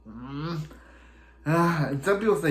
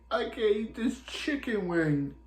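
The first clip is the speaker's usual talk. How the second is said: put-on drunk Barry; 7 semitones higher, 4 dB quieter than usual. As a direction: imitate a drunk, pitched up high. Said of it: "Barry is drunk".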